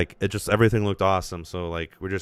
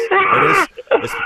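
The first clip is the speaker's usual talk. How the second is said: excited outburst; 9 dB louder, slower than usual. Real, not acted. Excited outburst, loud and high.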